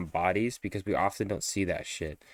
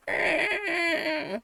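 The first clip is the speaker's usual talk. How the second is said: high hammed-up monster shriek; shrieking like a game monster; monster cry. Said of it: "the monster goes".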